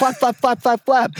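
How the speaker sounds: high, mimicking a flag flapping; wing flapping. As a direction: chant the flapping noise. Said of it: "Make a flag go".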